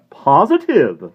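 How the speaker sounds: Positive slow, high and loud; emphatic. A narrator's reading. Florist assuring the woman, emphatic Positive. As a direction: hammer Positive home. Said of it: "said the florist emphatically".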